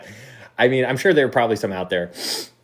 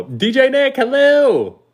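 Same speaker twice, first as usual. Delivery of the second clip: high, held, sing-out hello; loud high greeting; 7 dB louder, slower than usual. Real, not acted. Streamer greeting DJ Nick as he arrives, loud high call.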